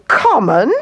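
high, affronted bird squawk; squawking emu. A narrator's reading. Emu stung at being called common, squawking. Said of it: "squawked the emu".

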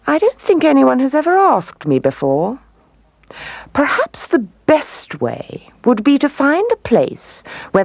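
musing owl with big swoops in pitch; pondering wise owl. A narrator's reading. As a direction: think it through aloud in a high voice.

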